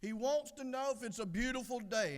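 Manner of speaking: high-pitched, testy repeat; a little irritated. Acted. Husband repeats it to his wife, a little irritated. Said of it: "getting a little irritated now".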